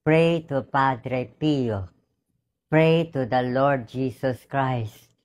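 slow solemn prayer voice; prayerful appeal. Acted. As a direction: pray over the people, slowly and low.

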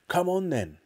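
high, eager summons; excited cry. A narrator's reading. Father calls his son off to search, excited. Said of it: "cried Mister Killycranky".

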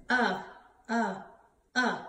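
"Uh" as a short, separate vowel; letter sound demo. Shown as a demonstration. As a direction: sound out "Uh" three times with pauses. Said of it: "Umba Umbrella Bird says".

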